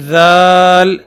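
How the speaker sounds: دال slow and loud but plain; simple soft dal. Shown as a demonstration. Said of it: "دال کی آواز نرم ہوتی ہے". (سادہ اور نرم دال)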